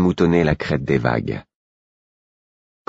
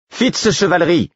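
sharp high call of a name; guard shouting a name down the corridor; 3 dB louder than usual. A narrator's reading. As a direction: call him through the door, high. Guard calls out his name.

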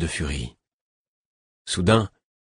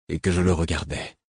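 usual speaking voice

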